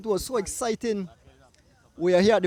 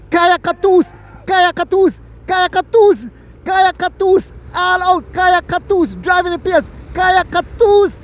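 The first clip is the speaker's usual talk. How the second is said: higher, louder chant of the rider's name; excited calling; 9 semitones higher, 10 dB louder than usual. Real, not acted. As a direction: hype her up as she attacks. Rider surges, commentator hypes her.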